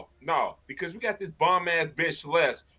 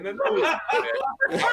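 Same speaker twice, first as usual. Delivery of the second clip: laughing; much faster than usual. Real, not acted. Laughing while leaving the stream.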